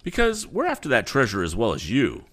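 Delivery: high, loud defiant rival; bold reply. Acted. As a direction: throw it back boldly. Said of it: "was the bold reply".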